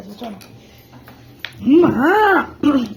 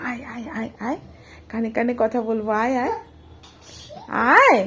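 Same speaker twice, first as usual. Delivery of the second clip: hushed, much softer coaxing; soft call; 6 semitones lower, 6 dB quieter than usual. Pretend. Mother softly calls her baby in for a secret. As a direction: draw the baby close quietly.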